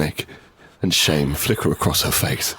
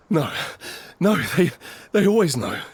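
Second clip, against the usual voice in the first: urgent fear; 8 semitones higher than usual. Acted. Narrator voicing panicked dialogue.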